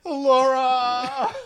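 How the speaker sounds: slow, high, loud drawn-out cry; anguished stretched call; mock anguished wail. Real, not acted. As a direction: wail the name in grief. Mourning a lost character.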